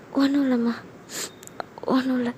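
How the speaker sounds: holding back tears before mother; understated weepy denial; mild tearful. Acted. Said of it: "ஏன் அழுகுற?".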